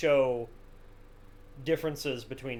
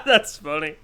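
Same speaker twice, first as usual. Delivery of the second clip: laughing through the words; real laughter, high and loud; 13 semitones higher, 15 dB louder, slower than usual. Real, not acted. Laughing at the fake surprise.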